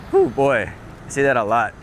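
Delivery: ordinary talk, nothing marked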